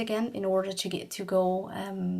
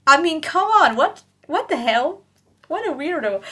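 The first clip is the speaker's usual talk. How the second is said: higher, louder disbelief; incredulous outburst; 7 semitones higher, 7 dB louder than usual. Real, not acted. Telling viewers about the weird cyclist, incredulous and louder. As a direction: throw up your hands in disbelief.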